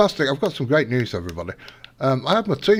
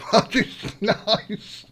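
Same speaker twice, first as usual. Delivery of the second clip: slow, laughing sarcasm; 9 semitones higher, much slower than usual. Real, not acted. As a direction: laugh it out slowly. Laughing at result.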